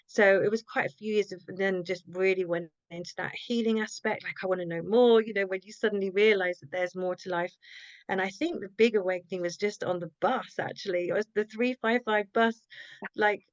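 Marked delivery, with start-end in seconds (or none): none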